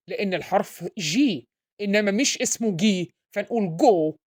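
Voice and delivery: usual speaking voice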